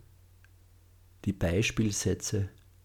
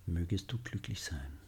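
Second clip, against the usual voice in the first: much softer, almost breathed repeat; hushed loving echo; 2 semitones lower, 11 dB quieter than usual. Shown as a demonstration. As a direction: echo the wish very quietly. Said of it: "Wiederholen Sie die Sätze sanft und liebevoll".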